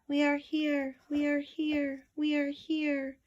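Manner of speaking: small crowd crying out far below; lightly voiced frightened chant; tiny fearful cry. A narrator's reading. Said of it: "they cried out in fear".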